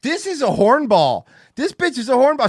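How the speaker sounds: loud teasing. Real, not acted. Teasing the crystal lady.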